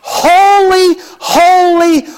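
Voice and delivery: ringing, lifted holy; crying out holy. Acted. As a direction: call it out like a seraph.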